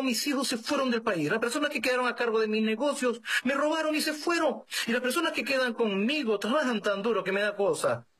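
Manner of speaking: high-pitched, urgent lament; pouring out troubles. Retold or imitated. Widower tells the priest why he is leaving, pouring out troubles. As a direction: unload one misfortune after another, pitched high.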